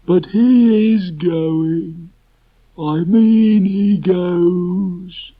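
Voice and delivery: very high, loud, slow donkey voice; a gloomy donkey stumbling through verse; Eeyore reciting his poem. Acted. Eeyore reads his farewell poem to friends, slow and mournful.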